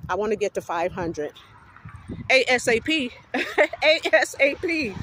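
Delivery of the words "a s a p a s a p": high, loud, spelled-out ASAP with a laugh; excited ASAP. Real, not acted. Vlogger hyping her subscriber goal, excited and laughing. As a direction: shout it out letter by letter, laughing.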